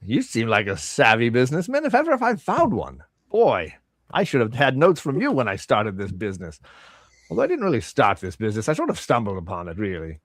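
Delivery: chatty merchant, pitch leaping on the praise; admiring shopkeeper. Acted. Shopkeeper praising a savvy businessman, chatty and impressed. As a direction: flatter the customer, then trail down into a confession. Game master plays shopkeeper.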